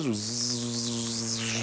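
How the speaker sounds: low, soft droning zzz; buzzing insect. Retold or imitated. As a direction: buzz quietly like a trapped wasp.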